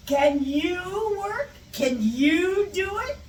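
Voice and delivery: "Can YOU work? Can YOU do it?" drawn out, climbing high; slow, needling questions that swoop upward; sarcastic intonation. Shown as a demonstration. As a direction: stretch "you" and rise mockingly to the end. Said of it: "You would just put the intonation".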